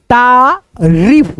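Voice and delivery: तारीफ with the री vowel drawn out very long; stretched long ee. Shown as a demonstration. Teacher dictating तारीफ to pupils, long ee stretched out. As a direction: say तारीफ slowly, holding the long ee in री. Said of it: "पहले उच्चारण सुनेंगे अच्छे से".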